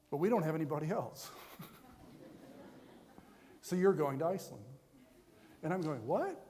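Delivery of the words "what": high, rising disbelieving "what?"; shocked. Acted. Student reacting to the chaplain's offer, shocked. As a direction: act out a startled double-take. Retelling his shock at the news.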